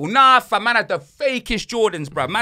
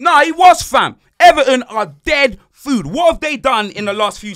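heated outburst; 6 semitones higher, 10 dB louder than usual. Real, not acted. Heated football argument.

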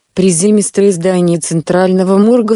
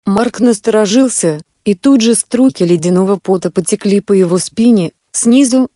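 usual speaking voice

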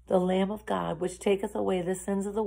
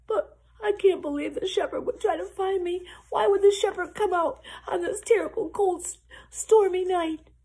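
high-pitched, fretful lamb voice; despairing little lamb; 10 semitones higher, 3 dB louder than usual. A narrator's reading. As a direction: doubt pitifully that rescue will come.

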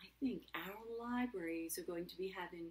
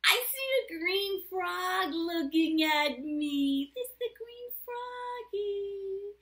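loud, slow sing-song picture-book voice, higher pitch; reading to children; 7 semitones higher, 13 dB louder, slower than usual. A narrator's reading. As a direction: stretch the answer out for little listeners. Reads picture book aloud.

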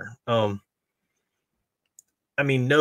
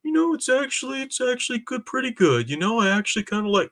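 high-pitched put-on voice of fans coming round; mock fickle fans; 13 semitones higher than usual. Mocking outraged people who will later like the movie, high mimicking voice. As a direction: mimic them in a much higher voice. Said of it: "are gonna start saying".

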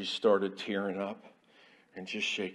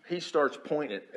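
ordinary delivery

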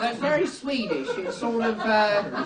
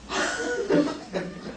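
high laugh; 12 semitones higher, 3 dB quieter than usual. Real, not acted. Laughing at magazine.

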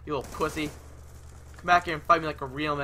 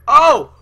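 triumphant whoop; 9 semitones higher, 17 dB louder than usual. Real, not acted.

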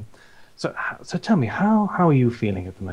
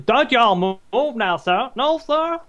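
a mock Southern accent, pitched high; terrible Southern accent; 10 semitones higher than usual. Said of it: "That's my terrible accent".

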